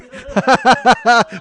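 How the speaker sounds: high, loud burst of laughter; loud belly laugh. Real, not acted. Comic after insulting Louisville, loud guilty laughing. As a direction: crack up at your own insult.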